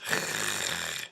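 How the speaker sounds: voiceless raspy throaty R, just breath; airy scraping sound at the uvula; unvoiced uvular fricative. Shown as a demonstration. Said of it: "that is gonna come out like this".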